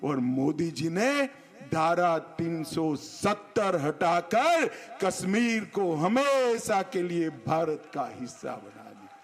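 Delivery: usual speaking voice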